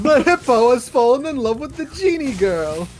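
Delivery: mock dramatic. Playful dramatic narration.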